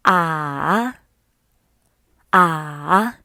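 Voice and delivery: ah dipping low, then rising. Shown as a demonstration. Teacher practicing third tone with students, ah falling then rising. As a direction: nod the pitch of ah down and back up. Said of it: "This tone goes down first and then rises".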